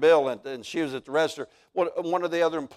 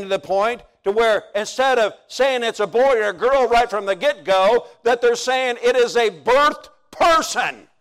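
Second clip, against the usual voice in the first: high, loud shouting sermon; full-force preaching; 6 semitones higher, 6 dB louder than usual. Real, not acted. Preacher building to outrage.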